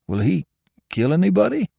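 high, anxious question; nervous repeat. Acted. Drummer repeats his question, high and nervous. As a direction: ask it again with worry. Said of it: "he said again".

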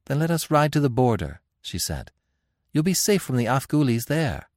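put-on high, urgent young queen. A narrator's reading. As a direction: press him to ride on. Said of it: "queen urging flight".

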